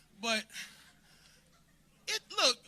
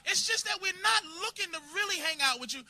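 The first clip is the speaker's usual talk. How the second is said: normal talking voice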